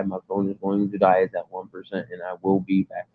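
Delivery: ordinary delivery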